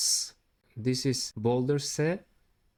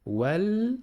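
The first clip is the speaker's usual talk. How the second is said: Wal held on a higher pitch; 8 semitones higher, 3 dB louder than usual. Shown as a demonstration. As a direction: hold the Wal up high. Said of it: "then hold it like this".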